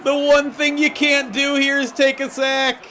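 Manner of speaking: high, slow, drawn-out complaint; exasperated wail. Real, not acted. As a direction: lament the blunder in a strained high voice. Exasperated at the sack.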